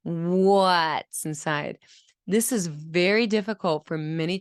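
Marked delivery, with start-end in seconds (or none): 0.0-1.8 "What's inside" very slowly, W stretched out; exaggerated W glide. Shown as a demonstration. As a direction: stretch the opening W of "What's" from pushed-out lips. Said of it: "glide as you're saying the W".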